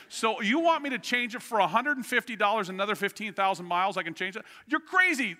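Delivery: high, indignant retold outburst; retelling a frustrated phone argument, pitch climbing. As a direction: build to a loud, exasperated peak, acting out the argument. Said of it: "I'm starting to get frustrated".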